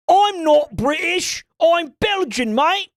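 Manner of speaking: high hammed-up Cockney-ish British voice; mock British bloke; mock British accent. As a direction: lampoon a bloke insisting he's not British. Mocking Nigel as British stereotype.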